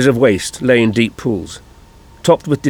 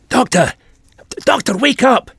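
high, alarmed cry; urgent call; 12 semitones higher than usual. A narrator's reading. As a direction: call urgently to wake him. Jamie shakes the unconscious Doctor awake.

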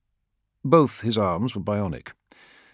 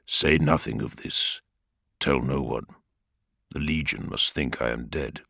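lower, soft commanding Primarch; grave Primarch; 7 semitones lower, 3 dB quieter than usual. Acted. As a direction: order secrecy gravely. Vulcan commands secrecy.

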